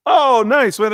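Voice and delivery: light up at the news; father thrilled at son's call; excited dad. Retold or imitated.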